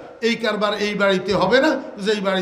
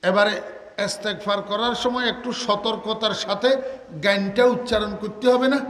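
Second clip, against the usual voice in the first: ordinary talk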